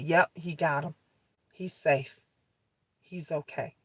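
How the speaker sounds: relieved calm. Retold or imitated. Officer reports son is safe.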